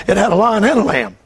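ordinary talk

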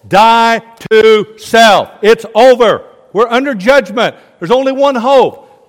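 very loud, high, emphatic proclamation; full-force preaching. Acted. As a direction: hammer out each warning slowly. Preaches Jeremiah's message at full force.

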